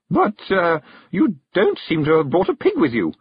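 high-pitched, hesitant puzzlement; taken aback. A narrator's reading. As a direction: query it politely, thrown off balance. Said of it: "taken aback".

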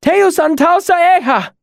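high, loud ringing invocation; loud cry held high to the end; shouted. A narrator's reading. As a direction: shout the words out like a charge against demons. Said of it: "she shouted".

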